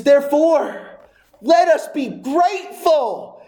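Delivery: loud, high proclamation of scripture; full-force preaching. Real, not acted.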